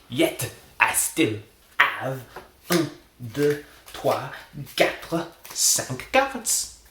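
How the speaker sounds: put-on loud, slow French accent climbing high. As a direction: count triumphantly in a mock French accent, rising to the end. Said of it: "Maybe drop the French accent".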